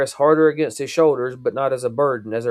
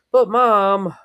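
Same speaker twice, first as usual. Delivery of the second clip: put-on high, loud child whine; whiny protest; 8 semitones higher, 5 dB louder than usual. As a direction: whine it like a kid.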